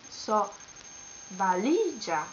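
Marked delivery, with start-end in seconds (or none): 1.4-2.2 valigia with LI stressed and lengthened; stress on middle syllable. Shown as a demonstration. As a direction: say valigia as va-LI-gia. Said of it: "accents on the last but one".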